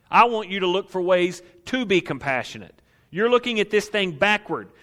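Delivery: high, loud preaching rebuke; full-force preaching. Acted. Preacher as Jesus rebuking disciples, loud and high. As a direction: hammer the disciples' excuses from the pulpit.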